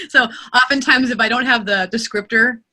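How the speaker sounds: ordinary delivery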